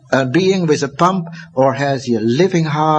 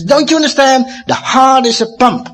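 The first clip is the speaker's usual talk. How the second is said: higher, urgent plea to understand; excited insistence; 8 semitones higher than usual. Retold or imitated. Harvey insisting to readers, higher excited voice. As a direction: press it home, amazed. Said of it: "he really gets excited".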